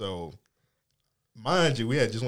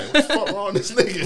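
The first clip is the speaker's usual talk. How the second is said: high, loud, laughing disbelief; bewildered outburst, half laughing; pitched up in mock alarm; retold alarm; 9 semitones higher, 6 dB louder, faster than usual. Retold alarm at man chasing.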